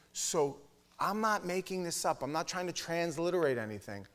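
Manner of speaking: ordinary delivery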